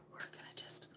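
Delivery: fading whisper. Real, not acted. Mom explaining her hungover kids, softly whispering. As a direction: drop to a hush to let them rest. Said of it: "I know I'm whispering today".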